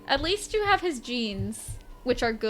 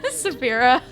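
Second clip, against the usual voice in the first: short shrill giggles around a name; high giggles; 5 semitones higher than usual. Real, not acted. Laughing at another death.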